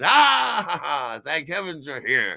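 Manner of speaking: big high booming laugh into a welcome; jovial, friendly greeting. Retold or imitated. Big party host welcoming the wine delivery, loud and jovial. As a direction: play a hearty, delighted party host. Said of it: "he's really friendly, and he's really in a good mood".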